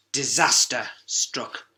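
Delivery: plain reading voice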